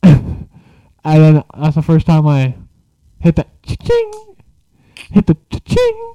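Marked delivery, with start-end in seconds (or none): 3.2-4.1 playful high voice. Acted. High playful sound effect. 5.1-6.1 playful high voice. Acted. Repeated high playful phrase.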